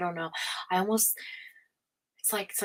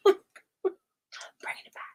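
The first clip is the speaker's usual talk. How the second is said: high, breathy laughing whisper; 12 semitones higher, much slower than usual. Shown as a demonstration. Echoing her own joke in a laughing whisper. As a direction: giggle the words out under your breath. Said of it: "I'm bringing it back".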